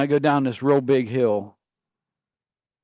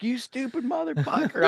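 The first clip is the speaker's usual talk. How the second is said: pitched way up, a strained scream; high scream; 12 semitones higher, 4 dB quieter than usual. Retold or imitated. Spinning driver screaming high at the buddy. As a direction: scream the insult in a high panic. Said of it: "I'm screaming at him".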